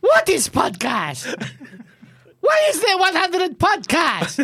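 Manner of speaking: slow, hammed-up Italian accent; mock-indignant Italian voice; Giacomo Agostini. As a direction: spell out bafflement in Italian-accented English. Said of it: "you need to translate this one".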